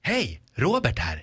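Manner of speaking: slow, deliberate spokesman impression; Boxer-Robert. Imitation battle entry for the two judges, Boxer-Robert. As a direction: imitate a TV ad character introducing himself, unhurried. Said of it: "Jag kan imitera honom".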